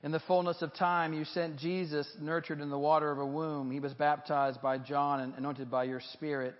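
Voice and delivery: solemn prayer. Acted. Continues the baptismal prayer.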